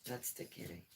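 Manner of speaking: quiet soothing coo; soft pet talk. Real, not acted. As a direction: settle the cat down softly. Soothing the cat.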